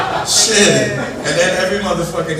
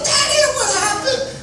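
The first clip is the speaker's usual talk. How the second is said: put-on much higher, little kid asking; child's voice. His kid asking about the news, high child voice. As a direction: mimic a worried kid.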